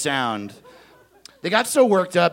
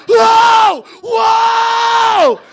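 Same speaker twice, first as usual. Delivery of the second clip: high shouted whoa; crowd roaring whoa; 10 dB louder than usual. Retold or imitated. Comic describing the crowd, shouting. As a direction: roar it like a stunned crowd. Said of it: "It just".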